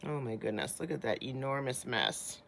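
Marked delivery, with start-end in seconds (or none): none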